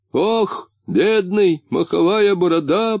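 high-pitched, acted wailing lament. A narrator's reading. Муфта pities his injured friend, lamenting. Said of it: "причитал муфта".

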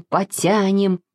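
ordinary delivery, nothing marked

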